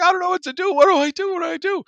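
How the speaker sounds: imitated panic, voice shooting up very high; Danielle. Coworker begging the prankster for what to do, mock panic. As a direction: plead helplessly in a high mock freak-out. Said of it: "obviously freaking out".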